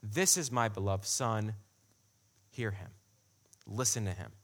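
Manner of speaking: solemn divine pronouncement, dropped low; voice from the cloud. Retold or imitated. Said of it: "a voice came out of the cloud saying".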